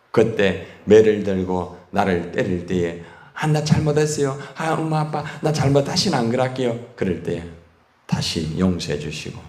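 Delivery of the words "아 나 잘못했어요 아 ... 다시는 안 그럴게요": higher, mock-pleading child. Child begging mom and dad for mercy, high and pleading. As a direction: beg like a kid about to be spanked.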